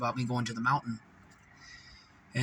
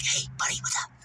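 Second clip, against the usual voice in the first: unvoiced whisper, sly and close; a creepy whispered greeting; demon whisper; faster than usual. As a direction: breathe it like a demon at your ear. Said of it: "little whispers of demons".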